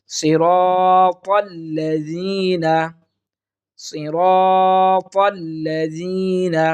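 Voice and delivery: সিরাতাল্লাযীনা chanted slowly; Quran recitation. Shown as a demonstration. Teacher reciting for students. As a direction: chant each syllable slowly.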